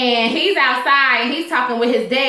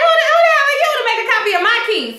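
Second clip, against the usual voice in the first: higher, fast, indignant outburst; outraged disbelief; 12 semitones higher, faster than usual. Retold or imitated. Venting on Esperanza's behalf about the copied key, higher and fast. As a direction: fire it off like you can't believe the nerve.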